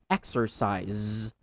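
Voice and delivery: ordinary delivery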